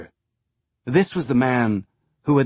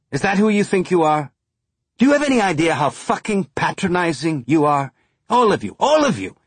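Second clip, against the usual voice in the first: higher, louder, bitter tirade; furious rant; 5 semitones higher, 3 dB louder than usual. Acted. Sakai lashing out at the Earthers, furious and loud. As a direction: let the pent-up hatred burst out.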